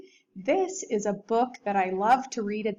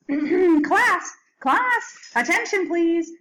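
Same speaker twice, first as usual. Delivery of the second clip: louder and much higher call to order; calling the class; 8 semitones higher, 7 dB louder, slower than usual. Acted. Principal calling the class to order, loud and high. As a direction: call the room to attention. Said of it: "said Missus Burton".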